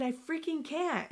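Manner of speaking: high, slow mock yell without volume; retold yell. Daughter repeating her protest to mom, restrained retold yell. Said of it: "And then I just yelled".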